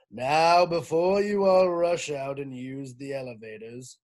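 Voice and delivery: loud principal on the PA; over the loudspeaker. Acted. Mister Kidswatter greeting school, loud loudspeaker announcement. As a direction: boom it out over the speakers. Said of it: "said Mister Kidswatter".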